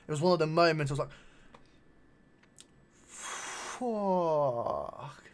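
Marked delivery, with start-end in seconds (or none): 3.1-5.2 long, drawn-out breath of amazement; awed swear. Retold or imitated. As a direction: imitate being blown away, stretching the word. Retold awed reaction to the shoe.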